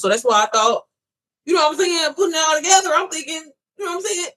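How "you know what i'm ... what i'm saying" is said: high, fired-up rush of realization; getting worked up as it clicks; excited. Real, not acted. To her viewers, getting excited as it comes together.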